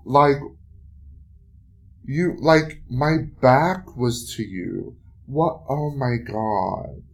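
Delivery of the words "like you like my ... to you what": indignant. Real, not acted. Outraged after street harassment.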